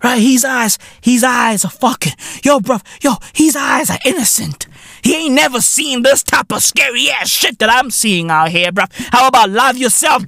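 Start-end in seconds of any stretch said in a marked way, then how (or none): none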